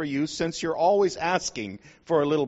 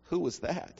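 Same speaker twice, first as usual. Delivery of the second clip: shaky little voice; 3 semitones higher, 7 dB quieter than usual. Acted. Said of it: "saying in a shaky voice".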